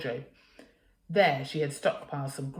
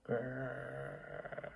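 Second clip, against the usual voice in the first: soft low monster growl; 5 semitones lower, 12 dB quieter than usual. Acted. Hidden creature growling under the rubbish. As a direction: rumble it low in the throat. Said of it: "heard a growling noise".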